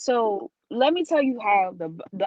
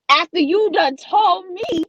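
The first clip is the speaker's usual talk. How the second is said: louder, slow and outraged; indignant; 6 semitones higher, 6 dB louder, slower than usual. Real, not acted. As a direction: lean into the outrage, slowly. Outraged retelling.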